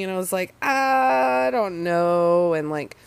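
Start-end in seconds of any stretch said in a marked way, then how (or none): 0.6-2.5 slow, stretched, wavering "I don't know"; drawn-out doubt. Retold or imitated. To the stranger asking a ride, slow doubtful stretch. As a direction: drag it out, unsure.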